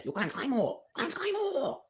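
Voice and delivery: high, excited chant of a champion's name; hyping up. Acted. Coach hypes up his trainee.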